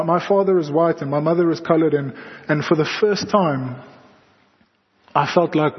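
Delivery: ordinary talk, nothing marked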